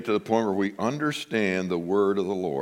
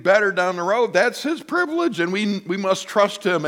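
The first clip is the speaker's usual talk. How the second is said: higher, earnest, rising fervour; fervent realisation; 9 semitones higher than usual. Retold or imitated. Pastor retelling his grateful prayer, fervent and higher. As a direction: marvel at God's plan.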